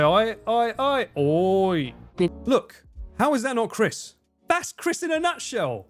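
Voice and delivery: usual speaking voice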